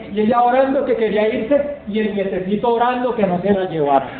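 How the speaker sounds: ordinary delivery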